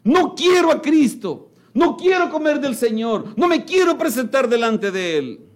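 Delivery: imitating a stubborn believer refusing; stubborn refusal. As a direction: dig in your heels, no no no. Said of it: "esa forma voluntaria de decir".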